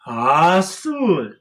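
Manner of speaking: high sneezed azul; sneeze azul. Shown as a demonstration. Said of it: "blue dog sneezes".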